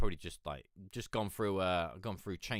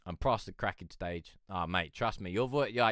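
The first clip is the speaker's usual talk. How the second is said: usual speaking voice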